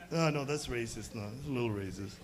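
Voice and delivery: ordinary talk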